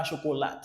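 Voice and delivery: "chocolat" as French-style "sho-ko-LAT", with a sounded T; wrong pronunciation demo. Shown as a demonstration. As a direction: say "chocolat" with an SH start and a flat A in the last syllable. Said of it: "c'est pas chocolat".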